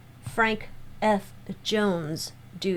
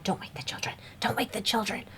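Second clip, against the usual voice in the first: hushed whisper, barely voiced; a ghostly hush, breath only; quiet whispering; 6 dB quieter, faster than usual. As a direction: whisper it so the kids stay asleep. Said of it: "There's this quiet whispering".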